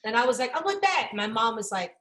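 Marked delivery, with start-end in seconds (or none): 0.6-1.1 put-on higher-pitched little kid whine for a toy; lightly voiced child insisting; demanding kid. Child demanding in retold quarrel.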